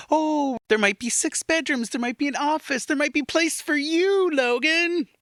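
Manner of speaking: high, sweet coaxing parent voice. Pretend. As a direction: lure him with a singsong promise. Imitating parents enticing Logan.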